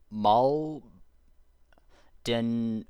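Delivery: mol- den- broken off, hard word; tripping over mol- and den- as false starts; stumbling over a word. Real, not acted. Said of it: "ah, I can't even say it".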